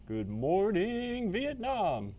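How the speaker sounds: high, slow, drawn-out radio host. Retold or imitated. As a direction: stretch it like a morning DJ. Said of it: "Sounds like a radio guy".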